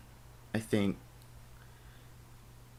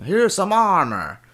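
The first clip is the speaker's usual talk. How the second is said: slow, higher, louder gruff game hero voice; Torbjorn impression; 9 semitones higher, 6 dB louder, slower than usual. Quoting Torbjorn handing out armor in Overwatch. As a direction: hand it over like the Overwatch dwarf. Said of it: "Torbjorn used to give out".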